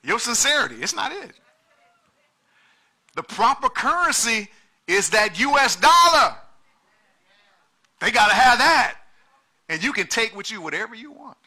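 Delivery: loud, high, driving preacher's cry; preaching at full force. Acted. Pastor pressing the congregation on currency, full-force preaching.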